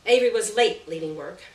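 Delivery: ordinary delivery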